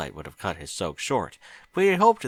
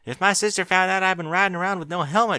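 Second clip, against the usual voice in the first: put-on higher young filly, chipper and eager; Apple Bloom's voice; 10 semitones higher, 5 dB louder than usual. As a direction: explain it in a rush like an excited kid.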